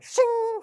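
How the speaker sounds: high, ringing "shing"; imitated sword-drawing sound. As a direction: imitate a blade sliding out, high and loud. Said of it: "he did the sound effects".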